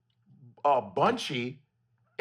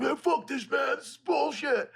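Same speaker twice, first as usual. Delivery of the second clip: fed-up shout; 11 semitones higher than usual. Retold or imitated. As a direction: bark it out like a fed-up kid. Movie characters giving up, retold.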